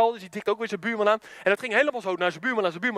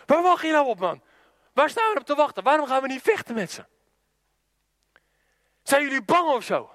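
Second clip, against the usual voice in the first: high-pitched, pushy disbelief; impatient challenge; 4 semitones higher than usual. Retold or imitated. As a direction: needle soldiers who will not fight. Little brother challenges waiting soldiers. (ongeduldig uitdagend, met hoge stem)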